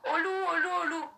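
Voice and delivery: high-pitched mock alarm call; mock cry for help. Storyteller imitates Pierre crying wolf to his village, high mock alarm. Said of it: "il criait".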